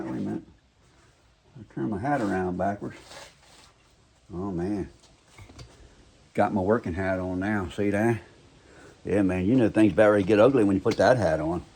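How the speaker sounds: ordinary delivery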